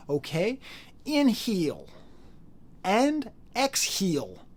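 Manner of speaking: high, slow Rhode Island yoga teacher; mock Rhode Island instructor stretching inhale; yoga teacher in a thick Rhode Island accent, mocking. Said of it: "Rhode Island accent ... talk like this".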